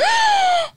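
loud, high-pitched gasp; sharp, voiced gasp of shock. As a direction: gasp in exaggerated scandal. Shocked reaction to own scandal story.

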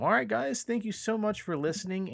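high, bright host sign-off; upbeat sign-off. Acted. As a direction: wrap up the show with energy. Podcast sign-off.

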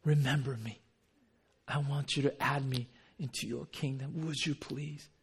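gentle, penitent asking; humble request. Retold or imitated. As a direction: ask meekly, fading to a hush. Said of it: "the attitude is completely different".

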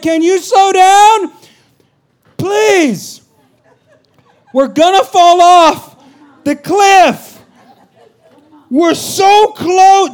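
high, loud, slow frantic pleading; terrified pleading. Retold or imitated. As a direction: beg the driver to slow down in panic. Retold panic on cliff drive.

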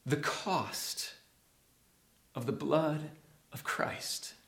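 soft, weighty phrases with long pauses; hushed reverence. Real, not acted. Preacher asks listeners to think about the cost, hushed and solemn. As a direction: let it fade almost to a whisper.

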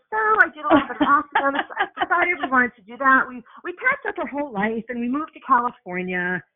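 teasing, sing-through summary with a smile; breezy imitation. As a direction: play back the guest's story as if it was all effortless. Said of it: "You make it sound like it was pretty easy".

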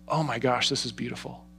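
very soft, breathy wonder; hushed awe. Shown as a demonstration. Pastor showing the moment everything clicks, hushed. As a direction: let it slip out in awe.

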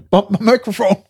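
high giggling through words; can't stop giggling; laughing. Real, not acted. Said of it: "I can't stop laughing".

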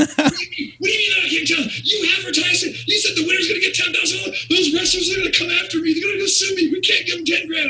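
shrieking high frantic boss, near yelling; fuming owner. Retold or imitated. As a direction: screech it like a boss in full panic. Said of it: "I'm fuming right now".

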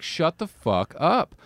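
acting out fed-up irritation, each word stretched; exasperated rebuke. Imagined rebuke to loud talkers.

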